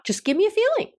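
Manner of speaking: high-pitched insistence at normal volume; mock pleading insistence. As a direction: re-enact pressing someone, voice jumping high. Pressing a resistant client for answer.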